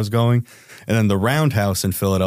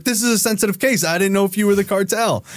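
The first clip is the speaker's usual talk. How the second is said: much higher sarcastic parroting of the agents; mocking echo; 10 semitones higher than usual. Parroting the agents' excuse back at them, sarcastic.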